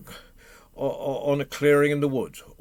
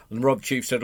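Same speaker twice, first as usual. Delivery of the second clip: ordinary delivery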